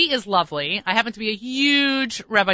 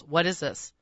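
disdainful question; 4 semitones lower, 6 dB quieter, slower than usual. Retold or imitated. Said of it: "he looks at me with disdain".

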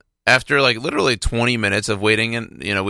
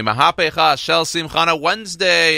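slowed, higher, louder title call; big show intro; 7 semitones higher, 3 dB louder than usual. Acted. Host kicking off the music segment for listeners, a big drawn-out title call. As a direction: sell the segment name with a flourish. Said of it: "one thing and one thing only".

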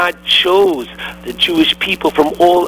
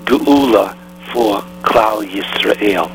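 slow solemn close; 5 semitones lower, slower than usual. Real, not acted. Closing blessing slowly.

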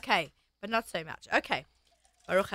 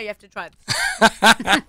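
laughing; 6 dB louder, much slower than usual. Real, not acted. Laughing while urging a friend.

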